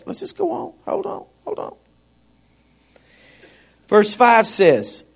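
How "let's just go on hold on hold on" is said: high, very soft voice; small, high-pitched hush, barely audible; hushed aside. Acted. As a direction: keep it tiny and high. Playful aside after a spooky remark.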